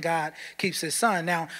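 usual speaking voice

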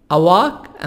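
أواك with open 'ah' vowel, American 'a wahk'; American-accented 'a walk'; American pronunciation. Shown as a demonstration. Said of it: "أمريكان".

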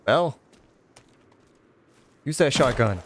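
ordinary talk

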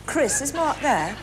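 laughing. Real, not acted. Caller corpses on phone.

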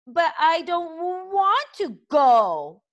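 high, loud, drawn-out creature voice; whining dragon. A narrator's reading. Toothless refusing Hiccup's order, high whiny dragon voice. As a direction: stretch the words into a high protest. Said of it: "Toothless pointed out equally reasonably".

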